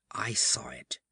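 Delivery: whispered, breath only, as if afraid to say it; hushed whisper. A narrator's reading. Narrator first glimpsing the thing from the sea, whispered. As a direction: let it out in a stunned whisper.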